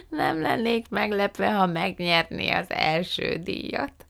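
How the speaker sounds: slow, high giggly aunt voice; giggle, then talk through the amusement; chuckling. A narrator's reading. Fan néni imagines Margó winning first prize, chuckling. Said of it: "kuncogott Fan néni".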